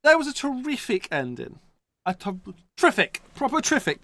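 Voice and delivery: high-pitched old football boss impression; Harry Redknapp. Football fan mimicking a manager's catchphrase, high-pitched impression. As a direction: put on an excitable old boy's funny voice. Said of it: "I was going Harry Redknapp".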